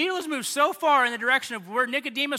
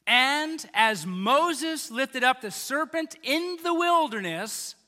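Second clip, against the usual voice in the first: slow, projected scripture reading; weighty, slow preacher's recitation; solemn scripture recitation; 3 dB louder, slower than usual. Acted. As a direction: proclaim the verse deliberately, phrase by phrase. Recites the Bible verse.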